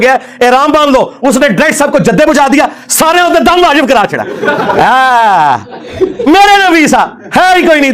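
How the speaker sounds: high-pitched, exultant debunking tirade; mocking outburst. Real, not acted. Preacher ridiculing a rival's holy-man tale, mocking outburst. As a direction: pitch it up and crow over the blunder.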